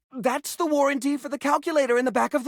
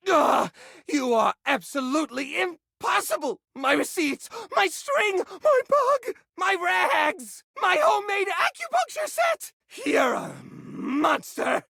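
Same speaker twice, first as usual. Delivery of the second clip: climbing higher with each item, ending in a shout; outraged rising rant; 6 semitones higher than usual. Acted. Hoarder mourning his thrown-out treasures, rising outrage. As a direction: build from a huff to a wail.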